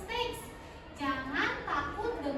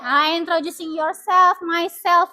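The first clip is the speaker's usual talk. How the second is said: much louder, projected teacher voice; loud classroom voice; 14 dB louder than usual. Real, not acted. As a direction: call the lesson out over the whole room. Teacher addresses class loudly. (suara guru yang lantang ke seluruh kelas)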